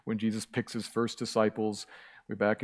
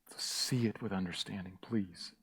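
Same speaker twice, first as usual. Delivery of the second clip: much softer, lower, gentle appeal; soft plea; 4 semitones lower, 10 dB quieter than usual. Real, not acted. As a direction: ask them quietly and earnestly.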